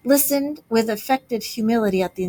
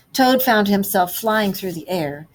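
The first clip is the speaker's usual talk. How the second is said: ordinary delivery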